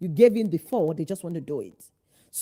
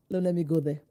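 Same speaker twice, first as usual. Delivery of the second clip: normal talking voice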